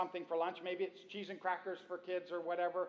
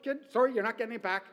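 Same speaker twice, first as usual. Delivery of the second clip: higher, louder possessive dog voice; voicing the dog; 5 semitones higher, 9 dB louder than usual. Preacher voicing a dog guarding dropped food, smug and possessive. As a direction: claim the floor as your territory. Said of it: "the dog is like".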